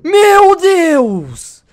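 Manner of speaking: high, loud shocked cry. Pretend. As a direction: gasp out in horror. Shocked at destroyed city. (grito chocado, alto e agudo)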